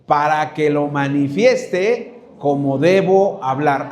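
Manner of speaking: "Para que lo manifieste como debo hablar" said slowly, word by word; slow recitation. Shown as a demonstration. Preacher leading congregation in slow recitation. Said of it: "Repita conmigo".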